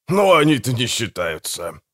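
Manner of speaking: ordinary delivery, nothing marked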